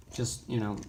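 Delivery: ordinary delivery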